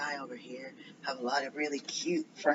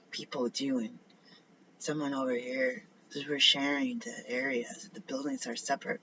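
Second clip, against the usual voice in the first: ordinary delivery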